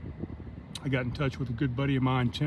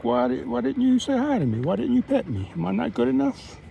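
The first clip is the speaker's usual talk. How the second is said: much higher whiny dog voice; hammed-up needy dog; voicing the dog; 10 semitones higher than usual. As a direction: pout it out as the hurt dog.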